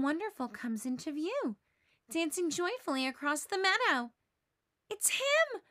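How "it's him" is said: much higher, breathless thrill; excited gasp. Acted. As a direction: gasp with sudden joy. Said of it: "Linus gasps".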